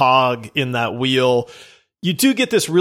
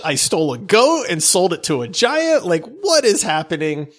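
normal talking voice

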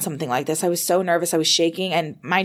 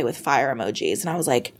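usual speaking voice

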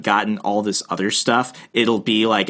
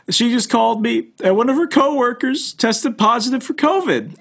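much higher mock voice of a panicked friend; retelling a friend's call, imitating him much higher; 13 semitones higher than usual. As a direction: play the friend up high and fretful. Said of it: "Instead, he says".

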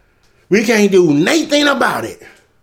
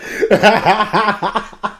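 laughing fit at your own bit; real belly laugh. Real, not acted. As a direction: burst out laughing, letting it tail off. Cracks up at crack fiend bit.

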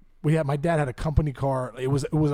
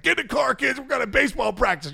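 dad calling out; 7 semitones higher than usual. Retold or imitated.

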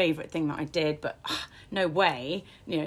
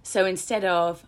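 ordinary delivery

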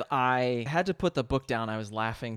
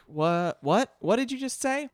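rising, higher-pitched mock confusion; baffled disbelief; 9 semitones higher than usual. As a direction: act out a puzzled double take, climbing in pitch. Acting out Cathari's baffled reaction.

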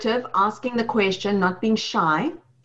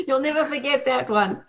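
laughing through the words, higher pitched; amused teasing; 5 semitones higher than usual. Real, not acted. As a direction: tease a friend while really laughing. Laughing at the ginger ale slip.

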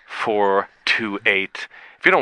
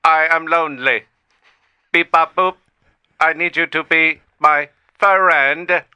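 higher, halting machine plea; lonely robot; 10 semitones higher, 8 dB louder, slower than usual. Pretend. AI asking Beep Boop for friendship, lonely robotic. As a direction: plead word by word like a robot.

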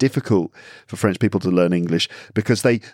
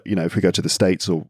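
ordinary talk, nothing marked

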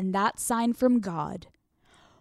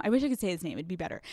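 plain reading voice

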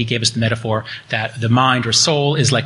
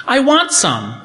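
playful high aside; 11 semitones higher, slower than usual. Retold or imitated. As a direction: squeak it out playfully like a kid. Father playfully adds his own wish.

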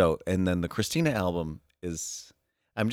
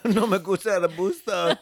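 higher, laughing through the words; laughing repeat; 9 semitones higher than usual. Real, not acted. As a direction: giggle out the catchphrase.

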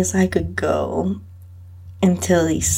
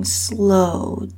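ordinary delivery, nothing marked

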